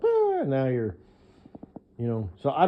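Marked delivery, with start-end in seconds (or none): 0.0-0.4 squeaky little gasp; a sudden high "ah"; imitating her high startled gasp; high mock gasp. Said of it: "and you were like".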